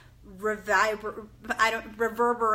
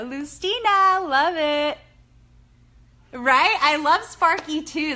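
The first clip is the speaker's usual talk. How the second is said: delighted; 5 semitones higher, 7 dB louder, slower than usual. Real, not acted. Delighted at viewer name.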